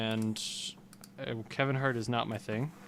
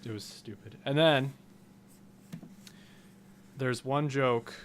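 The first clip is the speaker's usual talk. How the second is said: usual speaking voice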